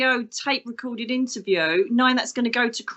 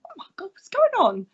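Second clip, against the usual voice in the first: high-pitched scandalised reaction; imitated shock; 9 semitones higher than usual. Church audience wondering what's happening, mock shocked voice. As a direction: mimic a startled congregation, pitched up.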